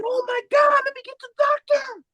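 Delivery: a hammed-up nurse in disbelief; shocked nurse. The nurse sends for the doctor, high hammed-up shock.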